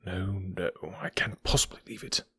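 low-volume, reluctant refusal; hushed musing. Acted. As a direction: murmur it as if to yourself. Holmes muses, reluctant to go.

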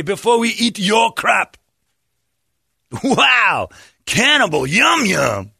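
high, loud hammed-up relish; mock villager voice. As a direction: savor it over the top. Voicing villagers tasting goat.

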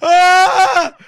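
rising exaggerated wail; mock distraught mother; mock mom wail. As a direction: mom despairing, hammed up. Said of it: "he was like".

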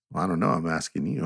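low, quiet voice of a laid-back friend; Philip. Retold or imitated. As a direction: imitate him talking low and soft. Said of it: "and this is how he talks".

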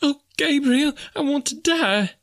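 very high falsetto wail; anguished cry. A narrator's reading. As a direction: wail slowly, wishing to die. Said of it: "cried the poor woman".

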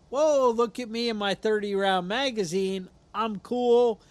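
loud, hammed-up boast; mock bragging. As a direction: play a cocky guy showing off his new toy. Mocks a cocky traveller showing off.

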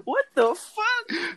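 high, slow, drawn-out disbelieving what the fuck; shocked disbelief. Real, not acted. A friend hears he's from Utah, high drawn-out disbelief. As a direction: stretch it out in high-pitched disbelief.

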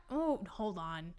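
ordinary talk, nothing marked

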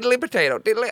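high-pitched, silly stage-Irish; mock Irish accent. Shown as a demonstration. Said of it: "can you do his accent".

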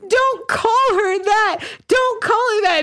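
imitating a tipsy kid defending her new friend; drunk upset girl. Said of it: "she's like".

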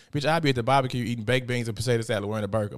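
normal talking voice

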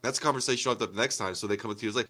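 ordinary talk, nothing marked

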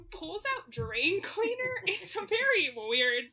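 high, loud and drawn out through laughter; laughing disbelief. Real, not acted. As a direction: crack up at the absurdity as you tell it. Laughs in disbelief retelling plot.

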